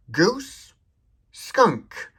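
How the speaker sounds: Goose jumped high and silly, pause, skunk; playful command demo. Shown as a demonstration. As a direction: toss out a silly word for the phone. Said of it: "you could say something like".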